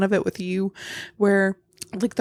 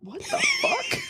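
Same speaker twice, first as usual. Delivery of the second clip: very high startled squeal; jump-scared shriek; 4 dB quieter, slower than usual. Real, not acted.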